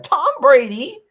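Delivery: slow, high, over-the-top host intro; Nardwuar impression. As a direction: shout out the team name like Nardwuar introducing a guest. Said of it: "that's my Nardwuar".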